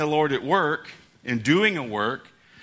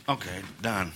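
offhand calm; 7 semitones lower, 9 dB quieter than usual. Retold or imitated. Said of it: "the Lord just goes".

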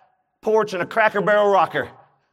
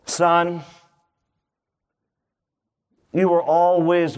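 tender father; 4 semitones lower than usual. Acted. Father gently answers older son.

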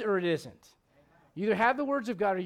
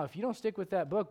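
ordinary talk, nothing marked

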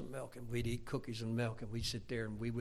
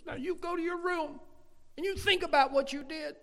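high, forceful imitation of a scolding mother; scolding mom; 6 dB louder than usual. Mom to son after a spanking, scolding. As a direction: lay down the punishment in a high mom voice. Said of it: "she'd say after she spanked me".

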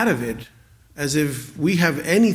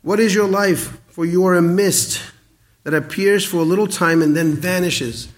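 louder, raised scripture reading voice; reading James aloud from the pulpit, proclaiming; 4 semitones higher, 4 dB louder than usual. Acted. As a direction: proclaim the verse to the congregation.

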